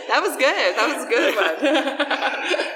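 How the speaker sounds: laughing through the words; real giggle while praising. Real, not acted. Laughing after pirate joke.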